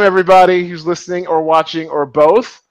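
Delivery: ordinary talk, nothing marked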